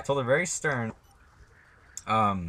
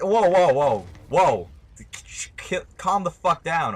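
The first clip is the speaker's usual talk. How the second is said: loud, higher-pitched whoas; alarmed protest; 7 semitones higher, 7 dB louder, slower than usual. Retold or imitated. Retelling his reply to a clingy classmate, alarmed protest. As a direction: push back hard with a raised voice.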